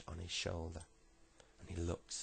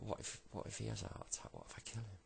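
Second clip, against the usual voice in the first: softer worried musing, trailing down; inner thought; 2 semitones higher, 7 dB quieter, faster than usual. Acted. Kid thinking about harming the man, hushed inner thought. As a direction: think it aloud quietly. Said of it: "But then he thought".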